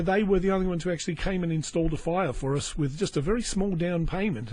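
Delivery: ordinary talk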